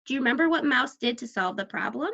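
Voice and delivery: normal talking voice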